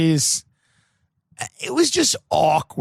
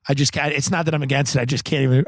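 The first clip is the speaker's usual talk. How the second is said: ordinary talk, nothing marked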